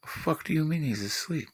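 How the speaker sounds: soft, incredulous mom; hushed disbelief. Retold or imitated. Mom to son about sleeping dad, hushed disbelief. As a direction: say it under your breath, stunned. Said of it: "that was the look".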